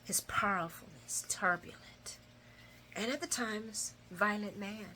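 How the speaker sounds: retold soft, deliberate taunt; hushed boast. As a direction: drop to an intimate hush and linger. Voicing Ava's intimate boast.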